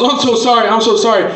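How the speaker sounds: high, panicked sorry; frantic apology. Retold or imitated. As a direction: blurt it out in a panic.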